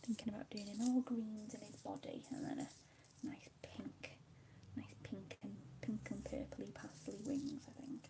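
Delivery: soft whisper over the colouring; hushed ASMR whisper. Acted. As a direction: whisper gently, as if not to break the calm.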